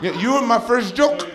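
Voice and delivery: high, loud mom voice mocking her son; drunk mom taunting. Mom taunting her comedian son, high and loud. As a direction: needle him like a tipsy mother. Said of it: "she goes".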